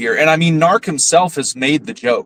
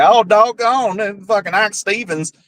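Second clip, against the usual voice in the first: higher-pitched put-on Texas twang; mock Texas accent; 5 semitones higher than usual. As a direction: imitate a phony Texan rambling on. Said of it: "even the Texas bullshit".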